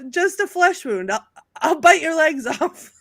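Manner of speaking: high, slow defiant bravado; hammed-up stubborn knight; quoting the limbless knight. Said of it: "and he says".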